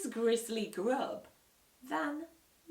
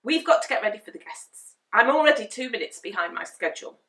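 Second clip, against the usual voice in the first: flustered rushed dad; 3 semitones higher, 13 dB louder, faster than usual. Acted.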